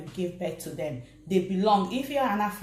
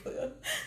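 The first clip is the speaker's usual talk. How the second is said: high burst of laughter; 11 dB quieter than usual. Real, not acted. Catches herself off topic.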